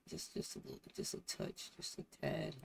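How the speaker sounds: very soft, unvoiced aside; barely audible muttering; hushed whisper. Real, not acted. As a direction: whisper it to yourself while fiddling. Muttering while rearranging the screen layout.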